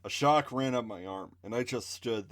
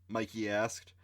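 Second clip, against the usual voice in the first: ordinary delivery, nothing marked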